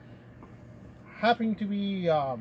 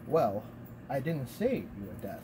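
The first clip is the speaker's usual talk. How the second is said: low cat voice; 6 semitones lower, 6 dB quieter than usual. Acted. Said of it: "the cat voices changes".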